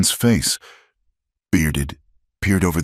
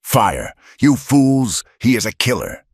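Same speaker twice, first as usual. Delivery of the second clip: screaming; 8 semitones higher than usual. Acted. Finch screaming at the posse to fire. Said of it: "Finch screamed".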